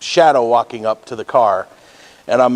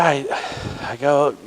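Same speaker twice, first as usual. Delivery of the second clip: ordinary delivery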